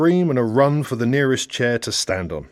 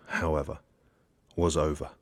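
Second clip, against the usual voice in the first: much softer and lower; somber fade; 7 semitones lower, 8 dB quieter than usual. A narrator's reading. Narrator closing the crash, somber and hushed. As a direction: let the dream die quietly. Dream ends.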